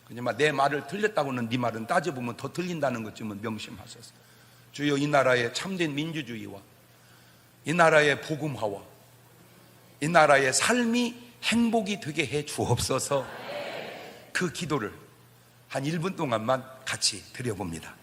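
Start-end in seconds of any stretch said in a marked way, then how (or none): none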